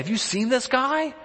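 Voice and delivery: high, mock-alarmed gossip; hammed-up awe of the giant; frightened soldiers. Preacher voicing Israel's men, scared of Goliath, pitched high. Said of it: "They said".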